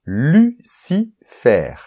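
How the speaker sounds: Lucifer said slowly, syllable by syllable. Shown as a demonstration. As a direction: break Lucifer into Lu-ci-fer, drawn out. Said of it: "moyen mémotechnique".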